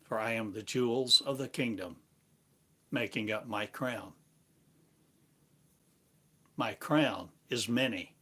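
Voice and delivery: ordinary talk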